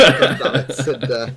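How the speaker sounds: laughing frustration at Cinder surviving; laughing groan. Retold or imitated. Fan reacts to villain surviving.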